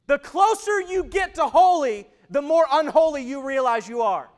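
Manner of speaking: loud, driving preacher voice; full-force preaching. Acted. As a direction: preach it out to the whole room.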